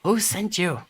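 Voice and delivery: high goblin voice, demanding; a goblin insisting on an answer; suspicious goblin. Acted. Goblin demands who sent the party, high goblin voice.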